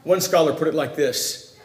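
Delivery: normal talking voice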